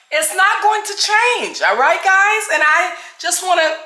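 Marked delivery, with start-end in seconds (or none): 0.1-2.4 emphatic. Real, not acted. Insisting on her loud voice.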